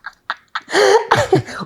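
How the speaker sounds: high burst of laughter. Real, not acted. Teasing Ricardo about getting annoyed, a high real laugh.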